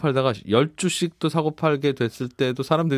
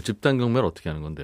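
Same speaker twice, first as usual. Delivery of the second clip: usual speaking voice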